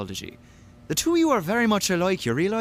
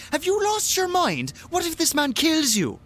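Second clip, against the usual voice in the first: alarmed disbelief; 11 semitones higher than usual. Acted. Friend alarmed at reckless wager.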